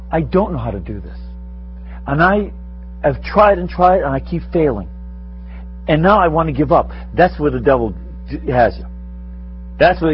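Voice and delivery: usual speaking voice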